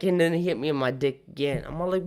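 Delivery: normal talking voice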